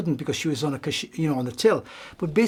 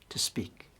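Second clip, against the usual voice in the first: whisper; 9 dB quieter than usual. Real, not acted. Soft close of the saying.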